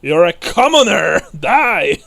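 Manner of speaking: mock knight's roar, high and very loud; outraged knight. Knight to the commoner slapping him, mock outraged shout. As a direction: shout it as an affronted knight, hammed-up. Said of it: "and he's like".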